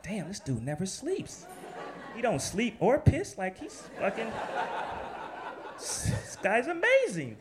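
laughing awe at the editor; laughing amazement. Retold or imitated. Amazed editor never sleeps.

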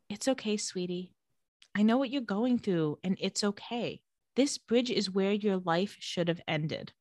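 soft, soothing mock-motherly voice; gentle and sugary, imitating a creepy mom; sweetest voice. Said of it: "in, like, the sweetest voice".

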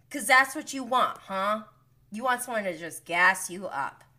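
ordinary talk

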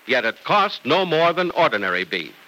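ordinary talk

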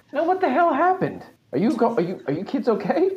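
alarmed Nisby. Acted. GM voices worried Nisby.

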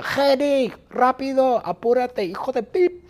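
high, impatient hurry-up yell; shrieky, high-pitched nagging; Bertita shouting. Acted. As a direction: shout urgently at a slowpoke. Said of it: "Bertita grita".

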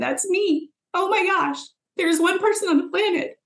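high, thrilled rush of recognition; light up like you finally found the answer; excited realization. Retold or imitated.

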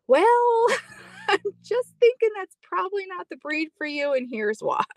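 high voice, patient let-down; high mock-polite voice. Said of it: "And I'm like".

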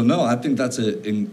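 ordinary talk

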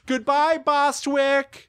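high falsetto farewell; drawn-out high mock farewell; cartoon old ladies. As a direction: bid goodbye like dotty old sisters. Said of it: "But they're like".

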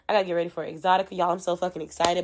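ordinary delivery

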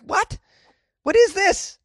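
retold shock, voice leaping up; high-pitched disbelief. Shopper reacting to twenty-dollar razors, high-pitched disbelief. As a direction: squeal it in mock outrage. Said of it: "I'm like".

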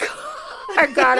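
retold tearful wail, drawn out; high, slow, half-laughing mock sob; half crying. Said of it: "kinda half crying".

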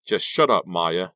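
furious outburst, dropping on the name; screamed. A narrator's reading. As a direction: lose control and yell. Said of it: "Della screamed".

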